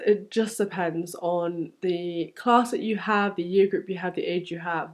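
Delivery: normal talking voice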